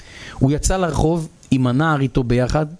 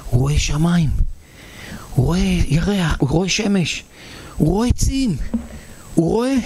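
mounting wonder; 4 semitones higher than usual. Acted. Storyteller reliving first sight of sky and trees, mounting wonder. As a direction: wonder climbing higher with each phrase.

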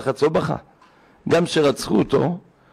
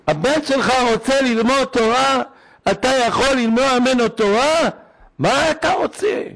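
imitated pleading rabbi; 9 semitones higher than usual.

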